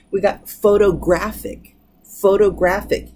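photographic, stressed on GRA, the second-to-last syllable; stress on the penultimate. Shown as a demonstration. Teacher showing the class photographic stressed on GRA. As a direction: say photographic with the beat landing on GRA. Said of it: "You can hear it on the second to the last".

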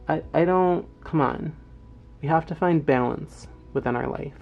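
ordinary talk

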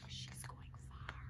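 hushed storyteller whisper; soft unvoiced whisper. A narrator's reading. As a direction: breathe it like a secret.

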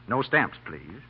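ordinary talk, nothing marked

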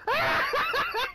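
sudden burst of high laughter. Real, not acted. Kill stolen mid-game.

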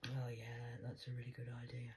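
fast, whispered sarcasm; mock agreement under your breath; hushed sarcastic yeah. Real, not acted. Sarcastic aside.

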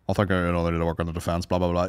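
low, fast pundit patter; rugby commentator. Retold or imitated. Ex-player doing the commentating, low quick pundit drone. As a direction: imitate a pundit rattling off analysis. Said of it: "when he's doing the commentating".

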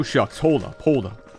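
normal talking voice

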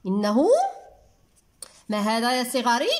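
usual speaking voice

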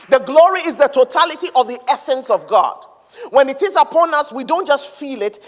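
loud, high, emphatic proclamation; full-force preaching. Real, not acted. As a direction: proclaim it boldly to the whole congregation.

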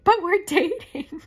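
high, loud mock-indignant squeal; hammed-up wounded boyfriend; mock protest. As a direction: whine it loudly, way up high. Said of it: "and he's like".

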